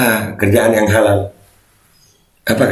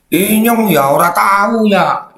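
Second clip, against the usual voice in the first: stretched, higher Banyumasan dialect; mock Banyumasan Javanese; 7 semitones higher, slower than usual. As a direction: play up a drawn-out Banyumasan accent. Jokey reply in Javanese dialect. (logat ngapak dibuat-buat, melengking)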